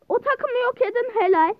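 high, loud cheer; excited cheer. Real, not acted. Gamer cheering a team wiped out, excited. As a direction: praise the kill loudly.

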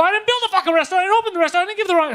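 high, heated rapid-fire protest; going crazy on him. Retold or imitated. Retelling himself on the phone to the courier, going crazy. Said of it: "I'm just going crazy on him".

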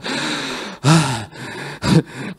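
long, soft exhale of relief; mock relieved sigh. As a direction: breathe out like you escaped death. Servant relieved after pardon.

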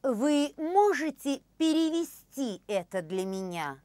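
usual speaking voice

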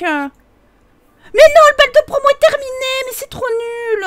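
high, loud disappointed cry; loud protest. Real, not acted. Player to viewers as prom ends, loud dismay. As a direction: wail that it's over already.

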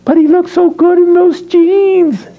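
high-pitched mock girlish gush; squeaky, put-on infatuated whine; swooning girl. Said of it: "I don't know why I hear".

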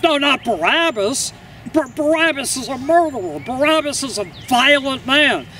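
high-pitched, insistent objection; urgent protest. Acted. An onlooker dismayed at Barabbas being offered, protesting urgently. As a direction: argue hard against the choice.

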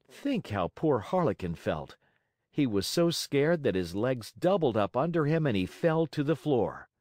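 ordinary delivery, nothing marked

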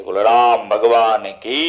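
slow, drawn-out call to the crowd; call for praise. Acted. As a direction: stretch it out, inviting the congregation to answer. Preacher calls for jai.

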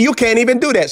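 normal talking voice

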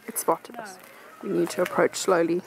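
nature documentary. Pretend. Documentary narration.

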